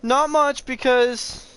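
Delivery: high, loud, fast promise; excited rush. Real, not acted. Gamer telling viewers he'll play only a bit longer, hyped. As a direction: hurry it out brightly.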